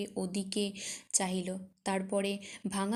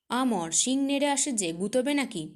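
sudden angry cry, higher and louder; angry outburst; 4 semitones higher, 5 dB louder than usual. Acted. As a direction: snap at him in alarm and anger. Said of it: "সক্রোধে বলিয়া উঠিলেন". (রাগে চেঁচিয়ে ওঠা)

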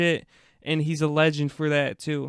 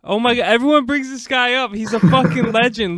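louder, higher-pitched fan enthusiasm; excited outburst; 8 semitones higher, 10 dB louder than usual. Real, not acted. Podcast guest reacting to this guy everyone brings up, excited. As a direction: burst out loudly in real excitement.

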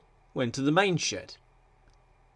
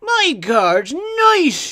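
high, loud, drawn-out wail; loud, high-pitched dismay; 14 semitones higher, 8 dB louder, much slower than usual. A narrator's reading. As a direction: wail it slowly like an upset little engine. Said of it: "he wailed".

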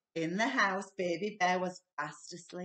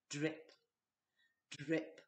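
a slow leaky tap at night; dripping tap; 6 semitones lower, 8 dB quieter than usual. A narrator's reading. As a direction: lower and softer, drop by drop. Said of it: "went the leaky kitchen tap".

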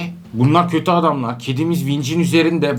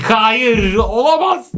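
drawn-out cry rising high; dramatic denial; 5 semitones higher, 3 dB louder, much slower than usual. Pretend. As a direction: cry out in mock horror, climbing in pitch. Melodramatic reaction to game disaster.

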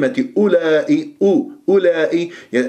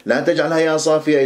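ordinary delivery

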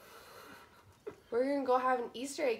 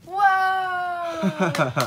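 excited whoa; 13 dB louder than usual. Real, not acted. Mom exclaims at sunglasses.

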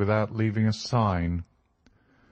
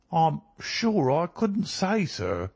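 high, drawn-out servant's voice; unsure cook; 8 semitones higher, slower than usual. A narrator's reading. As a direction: answer uncertainly, slow and pitched up. Cook answers Holmes, unsure.

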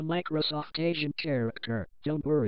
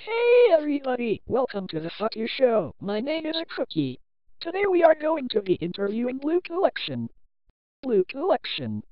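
much higher, louder, fast squeaky host voice; an overexcited cartoon host opening the show; hyper cartoon host; 8 semitones higher, 5 dB louder than usual. Cartoon cookie host welcomes everybody to the show, high loud excited.

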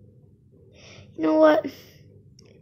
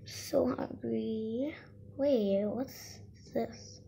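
weak and hungry; 10 dB quieter, much slower than usual. Acted. Weak with hunger.

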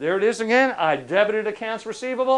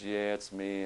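lower, softer sheepish reply; sheepish debtor; 9 semitones lower, 12 dB quieter, slower than usual. Retold or imitated. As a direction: mumble it like a caught man.

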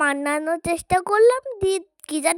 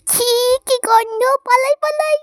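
higher and louder, squealing in disgust as if running off; 7 semitones higher, 5 dB louder than usual. Acted. Kids squealing in disgust at the mother's dirty diaper. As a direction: yelp with disgust at the smell and bolt.

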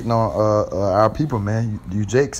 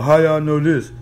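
a scoffing doubter, pitched a bit up and louder; mock the skeptic's challenge; mocking heathen; 4 semitones higher, 4 dB louder than usual. Preacher mimics a heathen questioning the Bible, scoffing. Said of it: "a damn, uh, heathen asking us".

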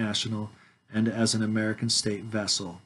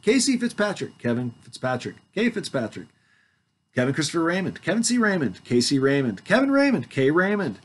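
rattling off the names in a higher mock tone; mocking list; 6 semitones higher than usual. Mocking the name variants for the witnesses. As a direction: make fun of every name variant. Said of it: "You guys see how silly this is?".

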